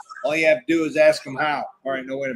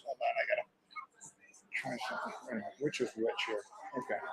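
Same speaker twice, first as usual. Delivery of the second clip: whispering; 4 semitones lower, 17 dB quieter, much faster than usual. Real, not acted. Testing mic before going live.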